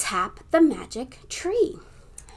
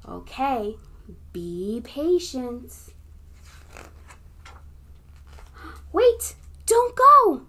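put-on broad singsong kids theatre; 3 semitones higher than usual. Acts out book for kids.

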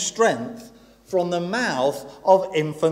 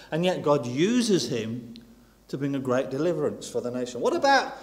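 ordinary talk, nothing marked

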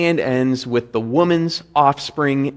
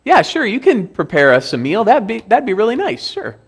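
casual, polite; 8 semitones higher than usual. Acted. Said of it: "So far, it's been".

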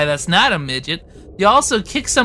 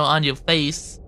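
ordinary talk

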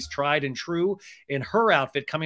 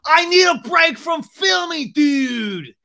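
high, drawn-out mock scream; hammed-up screaming tantrum; Sandoval; 13 semitones higher, 10 dB louder, much slower than usual. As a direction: wail it at full volume. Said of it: "Sandoval screams".

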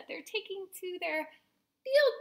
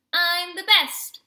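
loud, slow boast, pitched up; triumphant yelp; 4 semitones higher, 12 dB louder, slower than usual. A narrator's reading. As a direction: crow over everyone else. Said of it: "Coyote yelped".

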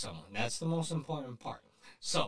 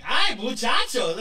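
excited greeting; 7 semitones higher, 8 dB louder, slower than usual. Retold or imitated. Jokey greeting voice to fans.

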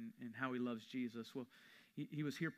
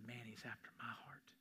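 softer, hushed personal confession; whisper; 3 semitones lower, 11 dB quieter than usual. Retold or imitated. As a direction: breathe it like a secret. Whispered personal realization quote.

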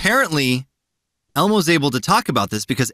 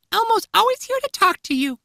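very high falsetto puppet voice; Elmo. Acted. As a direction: reassure like a cheery children's puppet. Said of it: "Stop with the voice".

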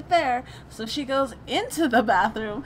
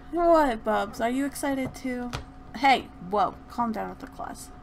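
normal talking voice

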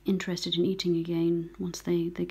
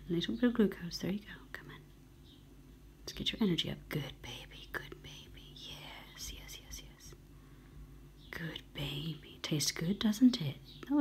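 gentle cooing whisper; 6 dB quieter than usual. Real, not acted. Rescuer coaxes a goldcrest to take glucose, hushed cooing. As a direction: coo to the bird under your breath. Hand-feeding a weak bird glucose.

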